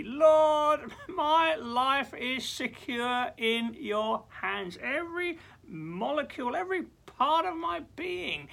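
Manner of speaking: high, loud, slow and earnest prayer; exultant prayer to the Lord; fervent prayer. Pretend. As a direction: cry out to the Lord with joy. Preacher voicing a heartfelt prayer.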